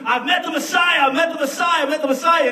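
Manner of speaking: high, loud breathless announcement; excited brother. Acted. Brother running to Nathaniel, high and excited. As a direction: burst in with the news.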